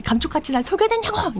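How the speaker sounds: high, incredulous accusing cry; wife accuses husband of fooling her, high and incredulous; betrayed outrage. Retold or imitated. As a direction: throw the lie back at him. Wife confronts husband over deceit.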